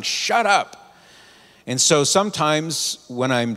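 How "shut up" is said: high mock shout; remembered shout. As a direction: echo the old coworker's yell. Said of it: "I can still hear him shouting".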